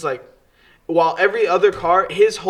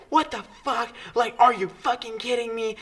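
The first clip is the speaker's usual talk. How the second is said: mock screaming lady; 8 semitones higher than usual. Narrator mimics the crying lady yelling after the crash, high mock scream. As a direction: squeal it like a hysterical stranger. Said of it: "she starts screaming".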